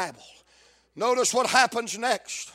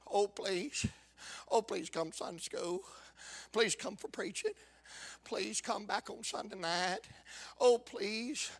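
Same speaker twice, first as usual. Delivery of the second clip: mock pleading; 10 dB quieter than usual. Pastor imitating begging members to come to Sunday school, mock pleading. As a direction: beg feebly under your breath, in mockery.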